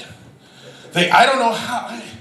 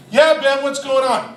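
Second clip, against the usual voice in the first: lightly voiced six-year-old, higher pitched; Connor; 6 semitones higher than usual. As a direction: imitate an unsuspecting little boy. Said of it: "Connor's the six-year-old. He just walks over like".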